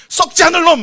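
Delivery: loud, high shouted blessing. Pretend. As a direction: cry out a blessing over a child. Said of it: "막 이러고 얘기하시고".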